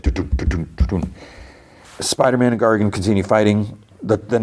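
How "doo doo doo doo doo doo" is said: paired thumping syllables; imitated heartbeat. Storyteller as the gassed man collapses, imitated heartbeat. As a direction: mouth a heartbeat in short double beats. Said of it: "his heart's like".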